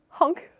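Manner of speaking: high, playful honk like a goose. Pretend. Goose honk closing the review.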